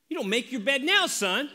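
exasperated dad. Retold or imitated. Father arguing with son.

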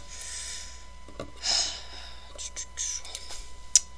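long, soft breathy sigh; drawn-out airy sigh. Real, not acted. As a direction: let out a long, quiet exhale. Worried about cats knocking figures over.

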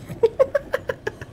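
high-pitched wheezing giggle, soft; a squeaked little laugh; high squeaky giggle. Real, not acted. Watching character get licked.

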